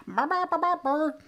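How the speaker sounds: high playful burr burr noises; silly noise. Retold or imitated. To a laughing stepmom, goofy high burring noises. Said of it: "the more you're like".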